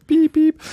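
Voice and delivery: high squeaky beep noise, laughing; censor bleep. Pretend. Mock censor beep for brand names.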